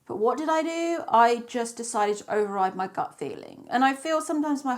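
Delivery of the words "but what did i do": usual speaking voice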